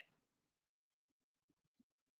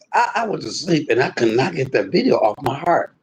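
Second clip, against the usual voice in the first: normal talking voice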